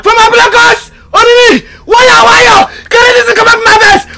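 crying shout. Acted. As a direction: wail at him to bring it back. Friend panics as charm fails.

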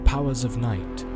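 plain reading voice

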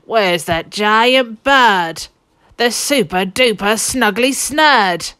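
a lightly voiced thief, slow and a little loud; slow, eager thief calling for the bird; Len's voice. A narrator's reading. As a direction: drag out the question like an impatient thief. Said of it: "And Len said".

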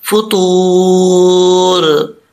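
फतूर with the वाव held long, one full alif length; drawn-out recitation. Shown as a demonstration. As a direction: recite फतूर slowly, stretching the long vowel. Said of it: "इसको लंबा करेंगे". (फतूर को एक अलिफ लंबा खींचकर)

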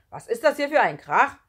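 loud, cross teacher's question; scolding. A narrator's reading. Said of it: "schimpfte Herr Rotbraun Bohnenhang".

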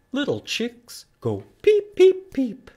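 high whispered chick peeps; baby chick peeps. Shown as a demonstration. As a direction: peep like a tiny chick, high up. Song verse, chick voice.

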